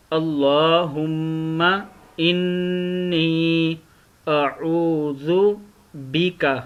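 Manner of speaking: slow recitation with long held vowels; Quranic recitation. Shown as a demonstration. Teacher modelling the kalma for learners, slow recitation. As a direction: recite phrase by phrase, drawing out the vowels.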